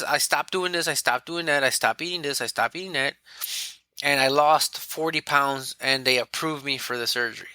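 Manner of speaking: usual speaking voice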